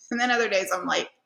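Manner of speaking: ordinary delivery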